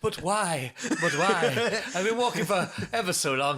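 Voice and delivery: bewildered whine, half laughing; mock protest. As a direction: plead with the taxi driver, laughing. Pleading with refusing taxi driver.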